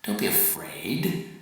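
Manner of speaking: soft unvoiced don't be afraid; whispered reassurance. Shown as a demonstration. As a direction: coax them in a whisper.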